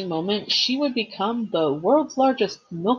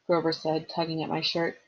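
usual speaking voice

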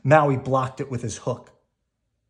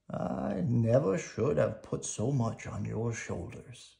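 tender apology; 6 dB quieter than usual. A narrator's reading. Grandma's spirit comforts Moana.

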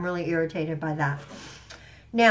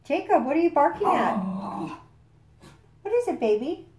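high calling then high pet-talk; talking to the dog; 9 semitones higher than usual. Real, not acted. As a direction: coo at the dog to check on him. Calls out to barking dog.

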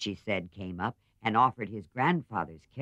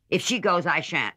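louder blunt refusal; stubborn older sister; Joe refusing; 8 semitones higher, 6 dB louder than usual. A narrator's reading.

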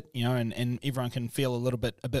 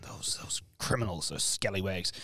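mock whisper; 5 semitones lower, 7 dB quieter than usual. Mock condemnation of tradespeople.

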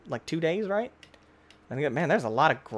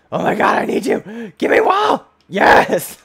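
high, loud panicked screaming; frantic shouting; 8 semitones higher, 9 dB louder than usual. Real, not acted. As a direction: scream desperately, then shout in triumph. Frantically grabbing wool in game.

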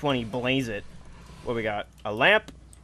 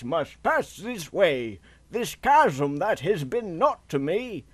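slow, theatrical poetry reading; poem recitation; slower than usual. Acted. Player reading an old bridge builder poem, slow declamation. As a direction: recite it grandly, drawing out each phrase.

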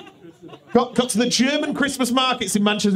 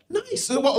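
ordinary talk

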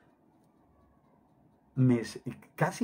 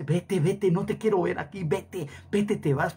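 higher, urgent, repeated dismissal; imitated possessed woman; 5 semitones higher than usual. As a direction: act out a woman driving someone away. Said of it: "esa, esa mujer dice". (mujer poseída imitada, voz aguda e insistente)

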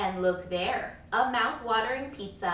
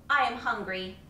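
ordinary delivery

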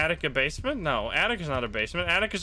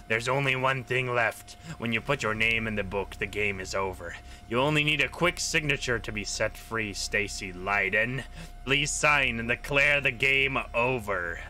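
sinister voice; 5 semitones lower than usual. Acted. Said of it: "It starts to talk in a sinister voice".

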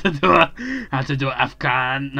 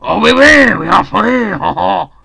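loud, high joyful whoop; excited cheer; 5 semitones higher, 10 dB louder than usual. Real, not acted. Players escape the game, loud joyful cheering. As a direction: shout in triumph at the win. Celebrating the win.

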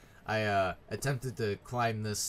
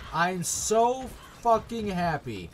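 higher, louder mock happiness; seething sarcasm; 6 semitones higher, 6 dB louder than usual. Real, not acted. Player forced to run through all this again, seething sarcasm. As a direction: build into exasperated, sarcastic complaint.

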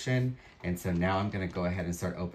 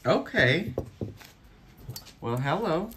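pleased surprise; 10 semitones higher, 3 dB louder than usual. Real, not acted. Reacting to new piece.

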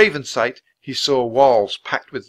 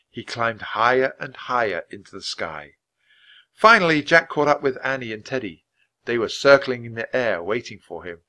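plain reading voice

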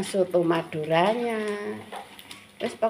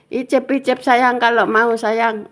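higher and louder, bright coaxing; cheerful offer; 5 semitones higher, 8 dB louder than usual. Real, not acted. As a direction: tempt the little one to taste. Offering food to child, lively. (menawari anak mencicipi dengan riang)

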